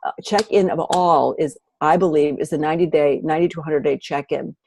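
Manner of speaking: ordinary delivery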